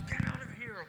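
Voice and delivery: very high, soft strained squeak; high stifled urgency; squeaky hushed shooing. Retold or imitated. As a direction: shoo someone out without the caller hearing. Shooing wife during phone call.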